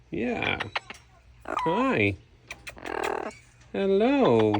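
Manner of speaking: high, loud sing-song cooing. Real, not acted. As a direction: coo sweetly at the bird. Cooing to a parrot.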